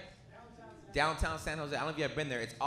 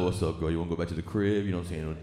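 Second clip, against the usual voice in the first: much lower, smooth pickup voice; smooth pickup line; 8 semitones lower than usual. Retold or imitated. Comic imagines a smooth guy picking up a girl, low and slick.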